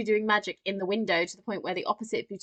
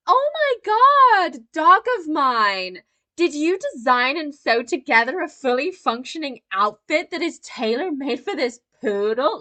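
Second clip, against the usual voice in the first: hammed-up owner gushing over the dog; gushing mock amazement; 6 semitones higher, 8 dB louder than usual. Podcaster imitating the owner marvelling at her poodle, high and gushing. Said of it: "she's like".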